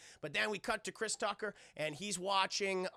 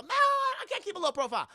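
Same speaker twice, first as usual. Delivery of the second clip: much higher, louder mock protest; Chris Tucker; 12 semitones higher, 7 dB louder than usual. Chris Tucker answering Jackie, high loud protest. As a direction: shrug off his warning loudly. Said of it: "Chris Tucker answering Jackie".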